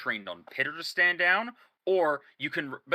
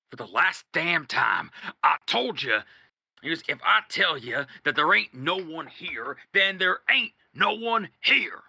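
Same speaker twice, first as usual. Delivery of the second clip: stern warning; 3 semitones higher, 5 dB louder than usual. Acted. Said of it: "forces it down and goes".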